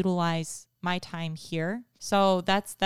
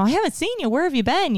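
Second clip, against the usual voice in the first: excited greeting; 9 semitones higher, 4 dB louder, faster than usual. Retold or imitated. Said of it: "I call her and I'm like".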